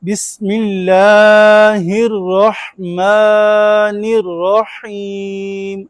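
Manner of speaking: drawn-out chanted Quran recitation; slow recitation. Shown as a demonstration. Teacher leads class in slow chanted recitation. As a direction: chant each word slowly, leaning on और. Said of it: "अब इसको मिला के पढ़ेंगे".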